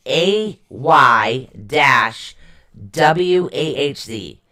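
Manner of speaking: very slow, letter by letter; spelled-out pronunciation. Shown as a demonstration. Host teaching a rune name, slow spelling. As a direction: spell it out slowly with gaps between letters. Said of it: "It's pronounced".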